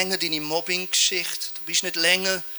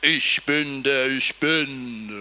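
a lightly booming, slow and lower divine voice; slow, lower and grand, a hint of thunder; mighty God voice; 6 semitones lower, much slower than usual. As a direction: announce it slowly like an awesome God. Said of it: "wie so eine krasse Stimme".

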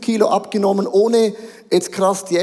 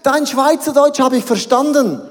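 higher, mock-amazed praise; impressed German listener; 6 semitones higher, 4 dB louder than usual. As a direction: imitate an impressed listener, pitched up. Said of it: "Dann kommen Deutsche und sagen". (gespielt begeistertes Staunen)

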